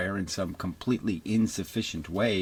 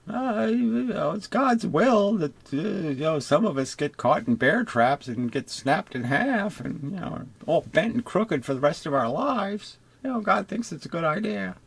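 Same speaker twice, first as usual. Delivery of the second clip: mock pious voice; 9 semitones higher than usual. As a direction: parody a syrupy believer explaining God's will. Mimics pious explanation of God's will.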